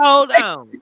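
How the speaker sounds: loud urgent call to wait; loud frantic. Real, not acted. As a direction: shout it to stop everything.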